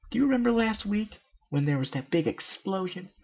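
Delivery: very high, bubbly cartoon pony; Pinkie Pie voice. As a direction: squeak it out like a hyper party pony.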